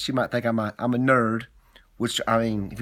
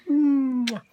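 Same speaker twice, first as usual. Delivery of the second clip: kiss sound; 11 semitones higher than usual. Acted.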